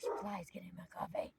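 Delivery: quick breathy whisper under the breath; whispered aside. Real, not acted. Speaker to herself about flies, whispered aside. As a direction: mutter it off to the side, whispered.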